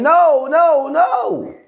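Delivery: high, alarmed pleading no; panicked no. Retold or imitated. Bystander begging as the officer stands by the truck, high panicked cry. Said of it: "one lady in the back was saying".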